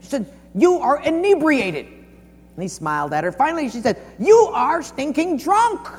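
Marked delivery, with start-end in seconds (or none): 0.5-1.8 high, loud mock scolding; hammed-up indignant accusation; unrelenting. Unrelenting woman starting in on Churchill again, indignant. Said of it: "unrelenting, she started in again". 4.2-5.9 high, loud, drawn-out mock outrage; hammed-up scolding at full pitch; unrelenting. Woman finally denouncing Churchill, loud exaggerated outrage. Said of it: "she, unrelenting, she started in again".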